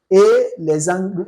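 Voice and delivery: ordinary talk, nothing marked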